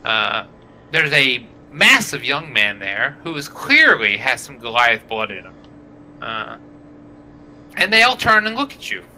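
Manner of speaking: usual speaking voice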